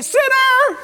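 sharp high yell of a judge. Retold or imitated. Archery judge yelling at the missed shot. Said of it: "the judge would say".

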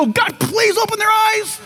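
pleading prayer. Retold or imitated. Believers praying at night.

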